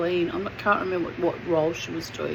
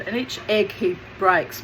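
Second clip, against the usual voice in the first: normal talking voice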